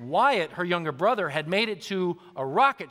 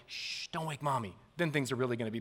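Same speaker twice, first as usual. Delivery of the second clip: hushed, trying to keep it down; 4 semitones lower, 8 dB quieter, faster than usual. Retold or imitated. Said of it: "trying to keep it on the down low".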